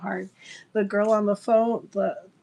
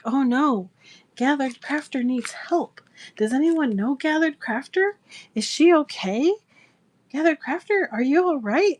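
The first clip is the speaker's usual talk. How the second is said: higher-pitched mock concern, questions lifting; worried seller; 5 semitones higher, 4 dB louder than usual. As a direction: imitate a sweet lady anxiously checking on someone. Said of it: "she is worried about me".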